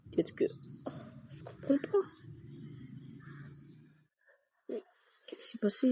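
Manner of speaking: hushed, breathless whisper of puzzlement; real baffled whisper at the damage taken; whispered confusion. Real, not acted. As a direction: whisper to yourself, lost.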